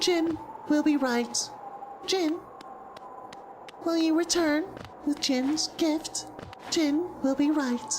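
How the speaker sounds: high-pitched, held robot-like voice; alternative creature voice. Shown as a demonstration. Trying another way of doing Jin, high-pitched level voice. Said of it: "There's definitely another way of doing it".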